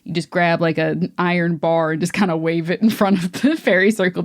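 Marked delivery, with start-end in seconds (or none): none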